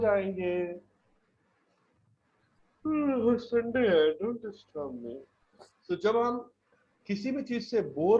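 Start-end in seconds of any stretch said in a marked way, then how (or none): 2.8-5.1 sleepy bored listener. Acted.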